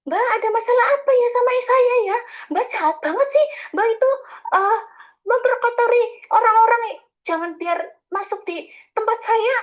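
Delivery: mock an indignant woman accusing her; angry accusation. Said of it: "langsungnya dia itu".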